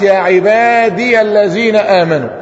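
slow "عبادي" sounded as "ibadiya" into "الذين"; full form with ya. Shown as a demonstration. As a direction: spell out the ya of "عبادي" by voice. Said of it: "فهنا الياء بتاعت". (إثبات الياء مفتوحة ببطء)

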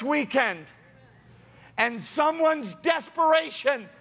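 rising, loud, urgent preaching; full-force sermon climax, high and loud. Acted. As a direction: drive it home to the congregation, voice climbing high.

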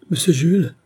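high, loud cry of alarm; wife screams his name in fear; high frightened shout; terrified cry. A narrator's reading. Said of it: "encore plus épouvantée que lui".

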